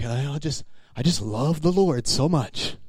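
soft, slow mock piety; false humility. Believer answering a compliment, falsely humble. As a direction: say it slowly, dripping with put-on devotion. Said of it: "That wasn't humble".

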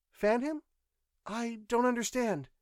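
high-pitched echo, rising on "him"; puzzled woman. A narrator's reading. Nurse questions the pilot's odd words, puzzled. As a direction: query it in a high female voice, baffled.

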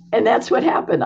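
ordinary talk

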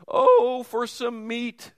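mock wail, drawn out and high; whiny complaining Israelites. Pastor voicing complaining Israelites with a mock wail. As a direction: whine it like a spoiled crowd. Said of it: "they exclaimed".

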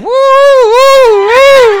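high, loud drawn-out woos; wild whooping. Retold or imitated. Waiter up on the table entertaining diners, wild whooping. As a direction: let out long, showy whoops.

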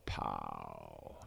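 very low, soft mouthed gunshot; a hushed imitated rifle pop; low mock gunshot; gunshot noise. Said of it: "And I go".

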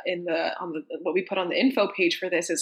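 ordinary talk

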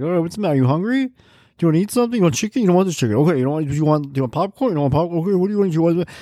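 soothing parent running through snack options; coaxing parent. Retold or imitated. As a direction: patiently offer food to a cranky kid. Parent coaxing a fussy kid.

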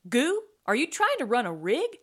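high, loud, incredulous demand; alarmed questioning. A narrator's reading. Tootsie confronting the wounded Goo, alarmed.

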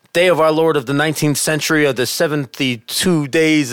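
grand Old English, imitated; mock Old English. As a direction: declaim it in put-on Old English. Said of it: "with the Old English or whatever".

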